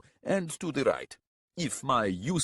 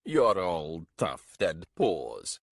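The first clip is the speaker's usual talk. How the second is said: slow, drawn-out sneer; 3 dB louder, much slower than usual. A narrator's reading. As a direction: put the pup down with relished contempt. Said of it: "Garalko said with a sneer".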